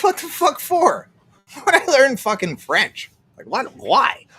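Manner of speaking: high-pitched, mock-outraged disbelief; incredulous retort. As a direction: act out a baffled, indignant comeback. Retells own incredulous pushback on French.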